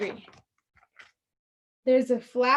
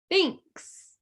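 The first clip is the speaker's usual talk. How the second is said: bear's thanks; 8 semitones higher than usual. A narrator's reading. Bear thanks hare in story.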